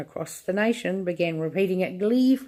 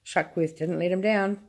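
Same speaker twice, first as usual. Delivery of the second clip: plain reading voice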